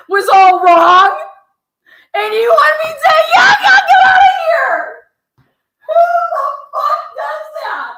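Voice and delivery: very high, loud, laughing outrage; incredulous shouting. Real, not acted. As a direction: shriek it in disbelief, half laughing. Ranting in disbelief at email.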